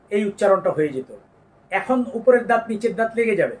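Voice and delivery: usual speaking voice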